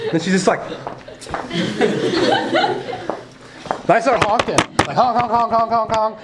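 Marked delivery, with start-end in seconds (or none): 0.6-3.2 squeaky breathless giggle; high airless laugh. Real, not acted. Laughing at the stare. 3.9-6.2 high, bouncy honk, honk; playful horn sounds. Retold or imitated. Mimics car horn.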